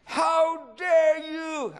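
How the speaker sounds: very high, loud, drawn-out shriek; outraged scream. Retold or imitated. Woman confronting the pastor in her house, acted outraged scream. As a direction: stretch each word into an indignant yell. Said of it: "Y me grita".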